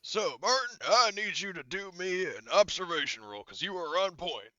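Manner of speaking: high-pitched sergeant giving orders; Gunny. Acted. Game master asking the point man for a roll, sergeant's order voice. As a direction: pitch it up like a sergeant assigning a job. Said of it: "I'm doing Gunny's voice".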